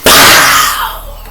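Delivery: sharp breathy pow; slap sound. Retold or imitated. Comic slap sound as he hits her. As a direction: spit out a slap noise.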